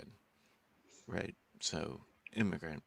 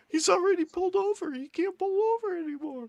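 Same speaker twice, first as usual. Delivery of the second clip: very high, loud, fast panicked squeal; movie cop quote; 11 dB louder than usual. Retold or imitated. Quoting the Super Troopers opening, panicked high squeal. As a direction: shriek it like a freaked-out stoned driver. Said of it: "the opening on the first one".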